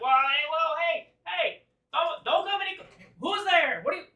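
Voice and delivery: high, loud squawky challenge; alarmed high-pitched sentry shouting intruders off; shouting creature leader. Acted. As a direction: yell out in a high little-monster voice. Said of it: "He just seems to be shouting out".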